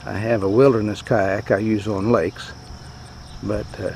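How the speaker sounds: ordinary talk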